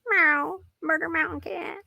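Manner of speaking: lightly voiced cat; mock cat voice. Voicing the cat.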